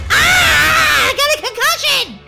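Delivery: high, loud playful scream; hammed-up crash scream. Gamer crashes on the bike, hammed-up scream. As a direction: shriek it as if just crashed.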